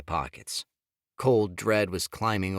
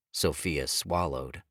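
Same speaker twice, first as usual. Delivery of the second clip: plain reading voice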